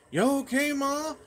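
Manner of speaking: high, loud call to another room. Retold or imitated. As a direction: holler a question out to Mom. Calling to mom from bathroom.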